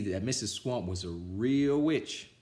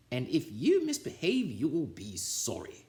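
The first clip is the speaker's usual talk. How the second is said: witchy teacher; 5 semitones higher than usual. A narrator's reading. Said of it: "said Missus Swamp".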